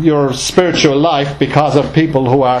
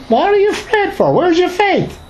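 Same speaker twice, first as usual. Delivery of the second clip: much higher, incredulous rebuke; rebuking. Acted. Jesus rebuking his fearful disciples, high and incredulous. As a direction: scold them for their lack of faith.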